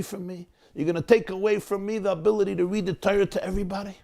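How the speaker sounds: put-on high, pained plea of a hurt old man; pleading old man. Old Moshe pleads with the gabbai, high and pained. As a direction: beg not to lose your moment.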